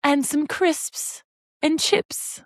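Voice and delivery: high, very slow put-on British voice; British accent. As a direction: drag it out like a posh Brit. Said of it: "say everything British we can think".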